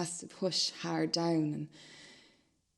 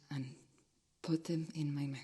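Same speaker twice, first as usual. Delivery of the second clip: whispered ending; 8 dB quieter than usual. Acted. Hushed ending of the scene.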